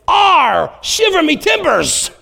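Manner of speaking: high, slow growling pirate chant. Retold or imitated. Son springs a pirate line on dad at pillow fight, in a pirate voice. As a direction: ham it up like a little cartoon pirate. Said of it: "like this pirate chant of some kind".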